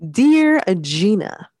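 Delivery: Dear Gina drawn out slow and high with a rise; slow dramatic opener. Shown as a demonstration. Host restarting the listener letter, slow and drawn-out. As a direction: savor Dear Gina like a grand opening. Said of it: "let me read that again".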